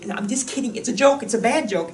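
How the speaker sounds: ordinary talk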